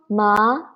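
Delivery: MA rising; second tone. Shown as a demonstration. Teacher leading learners together, ma rising.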